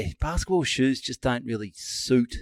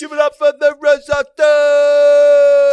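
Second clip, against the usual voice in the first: very loud, high, slow announcer shout; mock MC at home; 15 dB louder, much slower than usual. Joking about the rev up guy at home, loud MC shout. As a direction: hype your wife like an arena MC.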